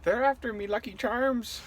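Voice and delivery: a cereal-ad Irish voice; nervous attempt at an Irish accent. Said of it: "[name]'s accent of the week".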